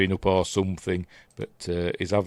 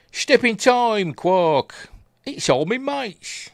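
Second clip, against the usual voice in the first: hammed-up Mary Poppins Cockney, much higher and slow; sing-song mock Cockney chimney sweep; 12 semitones higher, 4 dB louder, much slower than usual.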